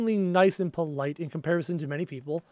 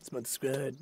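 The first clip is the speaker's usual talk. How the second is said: low, very soft catchphrase; sponsor squad murmur; 7 semitones lower, 16 dB quieter than usual. Real, not acted. Streamer murmurs his catchphrase, trailing off. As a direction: mutter the catchphrase to himself. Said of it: "I just like saying it".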